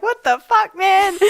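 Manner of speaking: retold outrage, high and loud; high incredulous what the fuck. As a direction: squeal it out, stunned and laughing. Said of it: "And I was just like".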